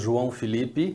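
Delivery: João Felipe spoken as a native from Rio would say it; Portuguese pronunciation. Shown as a demonstration. Guest telling the host his Brazilian name, in Portuguese pronunciation. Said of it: "my name in Brazil is".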